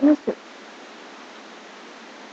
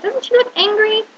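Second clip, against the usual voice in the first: usual speaking voice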